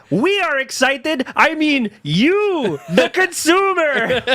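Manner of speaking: high, very slow pointed delivery; mock presenter. Mocking the presenter's pitch to consumers, high slow voice. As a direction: spell out the sales pitch slowly.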